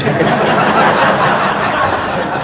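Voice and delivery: big real laugh; real laughter; loud high laughter. Real, not acted. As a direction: crack up at the memory. Laughing at own story.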